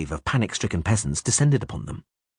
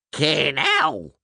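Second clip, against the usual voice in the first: high, drawn-out squawk; Field Marshal Windbag; 6 semitones higher than usual. A narrator's reading. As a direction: screech it like a bird. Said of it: "Field Marshal Windbag squawked".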